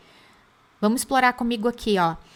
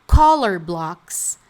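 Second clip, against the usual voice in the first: slow emphatic term; 3 semitones lower, 6 dB louder, much slower than usual. Shown as a demonstration.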